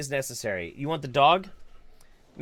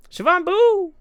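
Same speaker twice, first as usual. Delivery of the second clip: high, loud silly callout; squeaky playful shout; 6 dB louder than usual. Real, not acted. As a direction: sing out a pet name. Calling out playfully.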